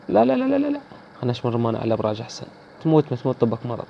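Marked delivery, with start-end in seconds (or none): 0.1-0.8 much higher, frantic string of no; squealing no as he gets hit; panicked no. Real, not acted. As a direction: protest in rising panic. Panicked no while getting shot.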